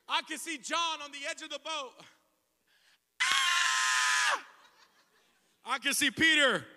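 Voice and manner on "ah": shrill high-pitched shriek, far above his voice; girlish scream. Retold or imitated. Panicked disciple in the storm, girlish scream. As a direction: squeal like a frightened little girl. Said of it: "screaming like little girls".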